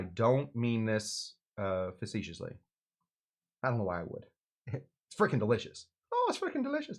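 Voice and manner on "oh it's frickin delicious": high, soft mock Irish accent. Shown as a demonstration. Host showing viewers his awful version, Irish accent.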